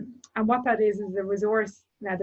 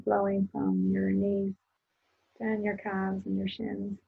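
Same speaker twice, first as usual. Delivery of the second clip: guided meditation. Acted. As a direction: guide the listener calmly into their body. Leads a closing meditation.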